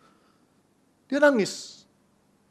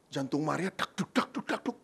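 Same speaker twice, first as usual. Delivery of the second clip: usual speaking voice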